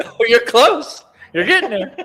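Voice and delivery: laughing while talking, high; laughing through the words. Real, not acted. Laughing while answering the guess.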